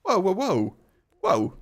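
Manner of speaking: loud, high startled whoa-whoa; surprised whoa. Real, not acted. Streamer reacting to mother's grim warning, startled and loud. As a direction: whoa in shocked protest. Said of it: "reacting to the mother's threat".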